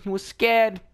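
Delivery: ordinary delivery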